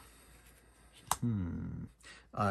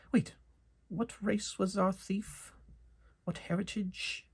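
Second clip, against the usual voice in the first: party member asks the merchant's heritage, higher; adventurer voice; 9 semitones higher, 3 dB quieter than usual. Acted. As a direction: raise the voice for a curious party member. Party member questions in character.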